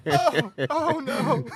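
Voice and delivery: high, slow, laughing "oh no"; laughing dismay. Retold or imitated. Retelling his arrest to the host, a high slow laughing groan. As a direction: stretch it out through laughter.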